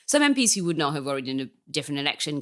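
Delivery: ordinary talk, nothing marked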